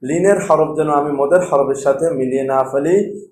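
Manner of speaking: ordinary talk, nothing marked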